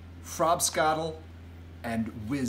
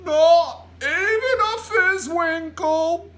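loud, high, drawn-out giant voice; larger-than-life giant reply; big friendly giant; 10 dB louder, much slower than usual. A narrator's reading. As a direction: boom it out slowly, pitched way up. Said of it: "answered the big friendly giant".

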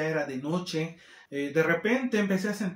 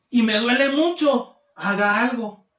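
higher and louder, pushing for help; desperate patient demanding help, higher and louder; pained plea; 4 semitones higher, 5 dB louder than usual. Retold or imitated. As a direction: beg the nurse to do something, louder. Patient pleading in pain.

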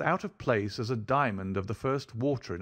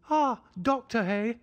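much higher and louder, eager little yelp; excited high cry; 13 semitones higher, 5 dB louder than usual. A narrator's reading. Hypochondriac host excitedly greeting the doctor, high cry. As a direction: pounce on the word Doctor with nervous delight. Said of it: "cried he, much excited".

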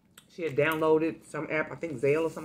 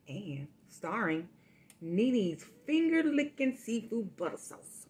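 slow, drawn-out showman announcement; grand announcement; 4 semitones higher, much slower than usual. Acted. Food vlogger introducing her star sauce, slow and grand. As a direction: unveil the star of the meal slowly.

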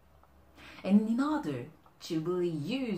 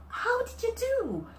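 very high, louder amazed question; delighted amazement; 5 dB louder than usual. Acted. Receiver asks the giver about the gift, delighted amazement. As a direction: marvel at it, pitch way up. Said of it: "the kind of look I like when I offer gift".

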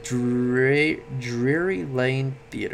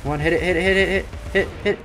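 higher, louder rapid urging; will the hit to land; excited urging; 7 semitones higher, 5 dB louder, faster than usual. Real, not acted. Urging attack in game.

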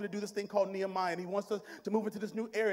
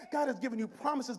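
usual speaking voice

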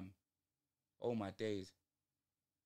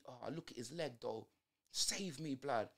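normal talking voice

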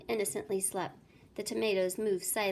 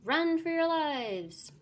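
high, loud despairing cry; cried out; 8 semitones higher, 5 dB louder, slower than usual. A narrator's reading. Mayor to fleeing citizens, loud despairing cry. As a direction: cry it out hopelessly. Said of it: "cried the mayor".

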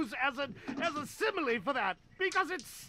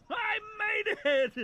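triumphant; 5 semitones higher, slower than usual. Acted. Game character finally finishes.

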